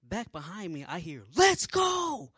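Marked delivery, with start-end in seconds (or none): 1.4-2.3 full high shout; yelling. Retold or imitated. Someone behind yelling at marchers, loud high shout. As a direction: yell it at the troops ahead. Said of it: "he yelling at me".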